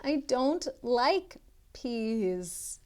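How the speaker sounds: usual speaking voice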